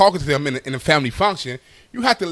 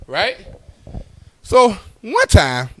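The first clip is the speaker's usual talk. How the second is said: usual speaking voice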